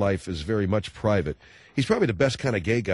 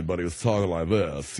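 Southern lisp; 4 semitones lower than usual. Retold or imitated. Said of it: "like he was from Carolina".

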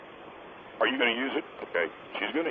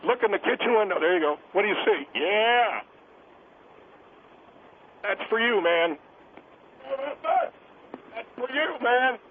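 calling out; 7 semitones higher than usual. Acted. Calling out to neighbor outside.

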